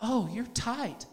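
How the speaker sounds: soft, slow mock doctor voice; the doctor quipping to him, quiet and slow; doctor's quip. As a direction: drop to a quiet, slow murmur. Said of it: "Hand to God".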